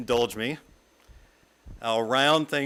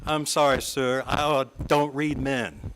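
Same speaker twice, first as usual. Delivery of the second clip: affected Southern stage voice. Shown as a demonstration. Speaker telling colleagues she reads no men, in a light Southern stage voice. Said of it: "in a somewhat affected stage voice, and maybe a slightly revived Southern accent".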